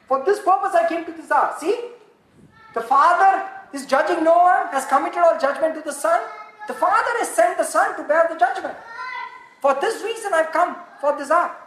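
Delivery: impassioned sermon; fervent preaching. Acted. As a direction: drive the point home to the congregation. Preaching at full force.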